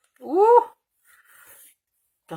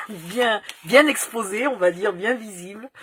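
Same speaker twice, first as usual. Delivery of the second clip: ordinary talk, nothing marked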